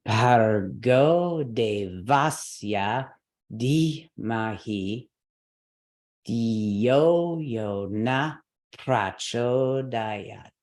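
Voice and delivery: very slow mantra, stressed syllables pushed; exaggerated recitation. Shown as a demonstration. Teacher reciting the mantra slowly, exaggerated stress. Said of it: "quite exaggerated".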